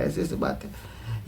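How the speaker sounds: slow, hushed whisper to his wife; late-night whisper so the secret stays in the house. Retold or imitated. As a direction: whisper it slowly, as if not to be overheard. Husband tells wife at night.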